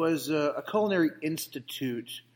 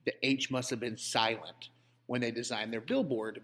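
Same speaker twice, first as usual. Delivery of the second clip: normal talking voice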